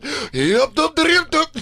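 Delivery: high staccato imitated kick cry; video-game kick noises. Imitating a Street Fighter spinning kick, high comic chant. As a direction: chirp out a mock spinning-kick sound. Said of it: "that kick where they go around".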